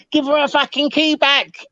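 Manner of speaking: high mock yell of the neighbours; angry neighbours. Neighbours banging on the window, mock yelled high. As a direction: shout it like the crowd at the window. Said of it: "banging on the window".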